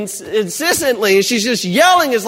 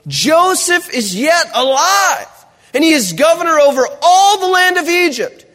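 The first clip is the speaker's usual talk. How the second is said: scripture reading; 7 semitones higher, 4 dB louder than usual. Acted.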